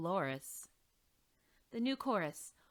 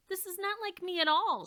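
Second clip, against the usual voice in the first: high, louder determined statement; a resolute higher voice; firm declaration; 11 semitones higher, 6 dB louder than usual. Acted. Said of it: "she declared".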